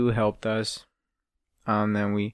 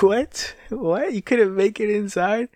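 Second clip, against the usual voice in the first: much higher, squeaky incredulous voice; hammed-up shock at the answer; mock disbelief; 9 semitones higher, slower than usual. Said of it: "And it's like".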